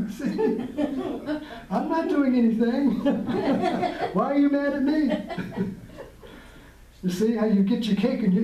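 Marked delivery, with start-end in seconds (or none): 0.1-5.7 higher, giggling fake innocence; laughing mock innocence. Teacher mocks his own innocence to students, laughing. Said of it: "so I can be innocent".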